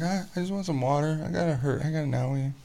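put-on high whimpering little-girl voice; whiny hurt kid. Dad imitating his limping daughter, high and whiny. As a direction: whine like a child with an owie. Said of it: "limping around the rest of the night".

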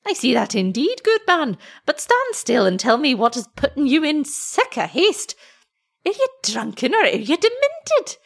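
high, swooping Scots scolding; anxious old wife. A narrator's reading. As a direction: question a husband gone mad. Wife questions husband.

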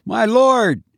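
high, startled cry of fear; frightened cry. A narrator's reading. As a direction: cry out, caught in sudden dread. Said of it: "he cried".

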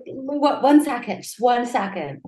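mildly urgent retold protest; retold pleading. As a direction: beg for a brief pause, lightly acted. Acts out pleading for a pause.

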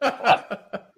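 high, laughing disbelief; a stunned laugh of disbelief; laughing shock. Retold or imitated. Seeing his naked photo on Google, a laughing shocked what.

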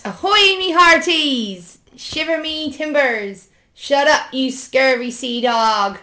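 high, slow, drawn-out pirate cries; hammed-up pirate hollering with long stretched vowels. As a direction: play a corny storybook pirate. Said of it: "I spoke like a pirate".

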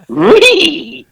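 Scrappy Doo impression. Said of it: "That sounded like Scrappy Doo".

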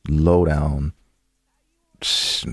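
ordinary talk, nothing marked

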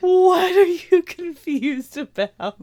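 high, drawn-out incredulous question; loud exasperation. Real, not acted. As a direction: stretch it out loudly, pitch way up. Exasperated at friend's confusion.

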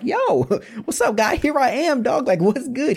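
high, laughing street-casual greeting; playful laid-back dude voice; casual Moses. Retold or imitated. Moses greeting God at the bush, high and playful. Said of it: "Moses was like".

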